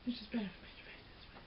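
fast whispered aside; whispering. Real, not acted. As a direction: rush it out under your breath. Muttered aside about lighting.

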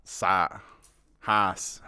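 soft, low echo of East London vowels; imitated East London accent. Host copies panellist's certain words, imitated East London accent. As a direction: mimic East London "sat" and "Haas". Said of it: "that's an East thing".

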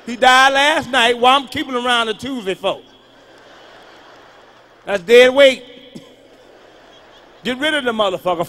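loud mock-callous rant. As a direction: rant like a cold-hearted relative.

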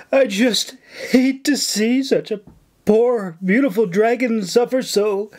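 high-pitched, worked-up distress; breathless gasping. A narrator's reading. Nora lamenting her dragon to the governor, gasping. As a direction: gasp it out in put-on anguish. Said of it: "Nora gasped breathlessly".

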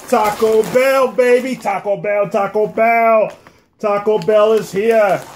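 drawn-out hype, Taco Bell; excited announcing. Real, not acted. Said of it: "hyping the next item".

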